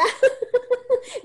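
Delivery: a bright high burst of laughter. Real, not acted. Speaker laughing at her own invitation to fathers, high laugh.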